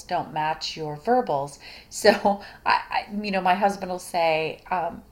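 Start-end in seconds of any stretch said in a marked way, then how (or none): none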